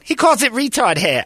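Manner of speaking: high put-on voice for the girlfriend; squeaky mock retelling. Girlfriend repeating dad's insult, high mock voice.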